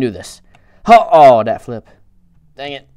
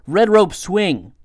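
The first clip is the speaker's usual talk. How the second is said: careful articulation; 7 semitones higher, 4 dB louder than usual. Real, not acted.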